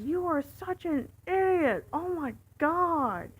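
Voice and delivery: high, loud exasperated insult; retold contemptuous shout; angry yelling. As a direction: imitate a furious passenger, dragging out the last words. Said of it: "he's yelling at me".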